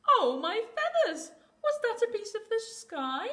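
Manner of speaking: high, loud squeak of a startled chick. Acted. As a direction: squeal it in alarm like a little chick. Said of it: "he squeaked".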